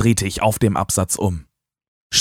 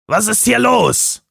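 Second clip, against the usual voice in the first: higher, slow, demanding; angry question; 13 semitones higher than usual. Acted. Bob bursts from the stable, angrily demanding answers. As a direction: demand to know what's happening. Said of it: "fragte er wütend".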